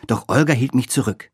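ordinary delivery, nothing marked

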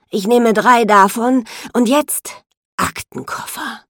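loud, slow, gruff orders; gruff man. A narrator's reading. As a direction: bark it like a brusque boss.

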